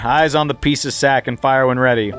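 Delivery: ordinary delivery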